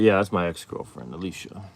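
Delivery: ordinary delivery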